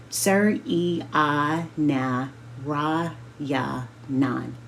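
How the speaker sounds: "Sir E I Na Ra Ya Nan" said slowly, syllable by syllable; slowly spelled out. A narrator's reading. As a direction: carefully sound out your own name for her. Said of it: "I say slowly".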